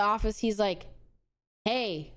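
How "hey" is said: a hint of a low, suave surgeon; lower, lightly played charming doctor; smooth doctor. Handsome surgeon greeting the girls, a hint of lower suave voice.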